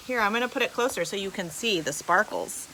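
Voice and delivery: normal talking voice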